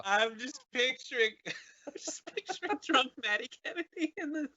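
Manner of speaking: high laughing through words; can't stop laughing, pitched high. Real, not acted. Guest picturing his old frat friend, laughing. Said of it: "I'm too close to this one".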